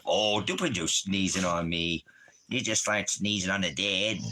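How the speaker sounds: ordinary talk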